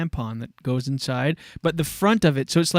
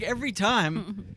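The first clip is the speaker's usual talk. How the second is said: ordinary talk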